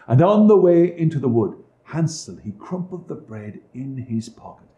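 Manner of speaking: ordinary delivery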